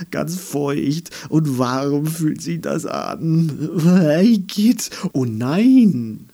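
hammed-up tree disgust. A narrator's reading.

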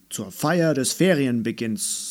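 dad trying to cheer her up; hint of a jovial father; light dad voice. A narrator's reading. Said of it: "sagte er".

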